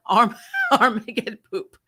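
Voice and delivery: ordinary talk, nothing marked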